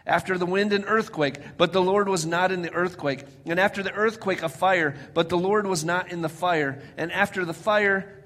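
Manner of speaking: loud, emphatic Bible verse; preacher declaiming scripture; dramatic scripture reading. Acted. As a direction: proclaim each phrase loudly from the pulpit. Reads scripture aloud dramatically.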